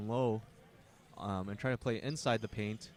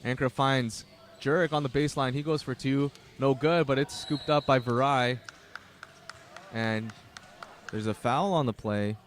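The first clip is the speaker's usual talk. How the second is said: brisk, loud courtside calling of each pass; excited sports commentator calling a basketball game; live play-by-play; 7 dB louder than usual. Acted. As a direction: call the action as it happens.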